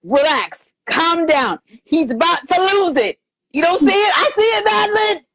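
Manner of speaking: rising, urgent plea to calm down; urgent warning. Retold or imitated. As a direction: warn Madeline he's about to snap. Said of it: "I'm going".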